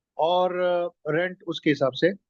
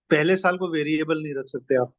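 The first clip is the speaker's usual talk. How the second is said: ordinary talk, nothing marked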